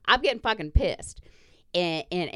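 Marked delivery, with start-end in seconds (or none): 0.1-1.2 heated, raised pitch and louder; riled-up, loud venting; getting pissed. Retold or imitated. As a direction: let the irritation flare up. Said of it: "I'm getting testy".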